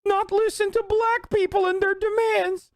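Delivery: hammed-up imitation of a panicky teen; mock anxious kid. Streamer mocking the anxious kid from the flag debate, high stammering voice. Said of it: "where the kid is like".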